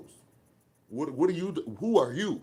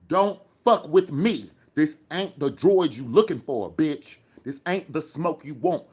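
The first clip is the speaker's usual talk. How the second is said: angry threat; 6 dB louder than usual. Real, not acted. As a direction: lean in and warn them off hard. Threatening a chat troll.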